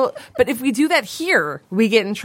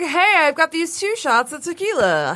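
lightly hammed-up, higher-pitched offer; pitched up, presenting a treat; retold cheery offer; 8 semitones higher than usual. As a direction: replay an upbeat greeting to a friend.

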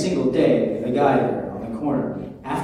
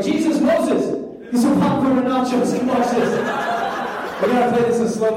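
raised, excited hammed-up call; mock God calling out; 8 semitones higher than usual. God calling Jesus and Moses over, high and gleeful. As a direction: gleefully summon friends to watch. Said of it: "It was like".

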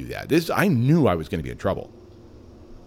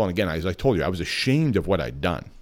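normal talking voice

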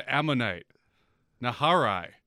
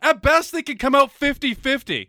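heated shout; 12 semitones higher, 7 dB louder than usual. Real, not acted. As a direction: yell it, fired up in the argument. Heated argument about samurai tactics.

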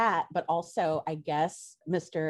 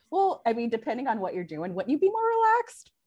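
puzzled; 7 semitones higher, 4 dB louder than usual. Retold or imitated. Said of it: "I'm like".

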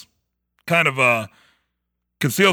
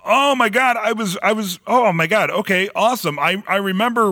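excited; 8 semitones higher than usual. Real, not acted. Realizes what happened.

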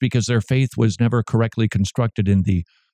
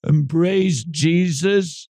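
higher, drawn-out mock begging; mock longing, stretched and pitched up; sissified boyfriend pleading; 5 semitones higher, slower than usual. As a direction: plead like a lovesick, wimpy boyfriend. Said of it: "present him as a sissified boyfriend".

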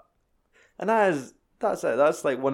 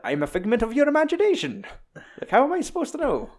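high, fast cartoon rat voice; squeaky rat voice; 12 semitones higher, faster than usual. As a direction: play the little rat, fast and exasperated. Voicing Remy the rat.

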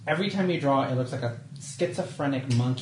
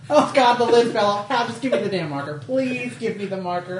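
exasperated; 7 semitones higher, 4 dB louder than usual. Real, not acted. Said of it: "You're driving me crazy".

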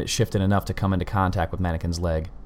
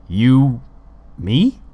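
puzzled; 5 semitones higher, 6 dB louder than usual. A narrator's reading. Colin puzzles at letters.

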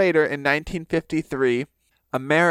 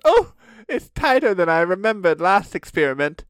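hammed-up British scientist, pitched up; mock British accent; 8 semitones higher than usual. Crick questions Watson about his exercises, in a mock British accent. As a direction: play a fussy British gent, lifting the pitch.

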